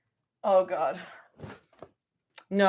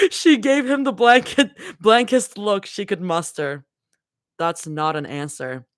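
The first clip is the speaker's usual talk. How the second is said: low, steady read-aloud of a fanfic chapter; story narration; 5 semitones lower than usual. A narrator's reading. Streamer reading a fanfic chapter to chat, low and steady. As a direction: read it like a cozy audiobook.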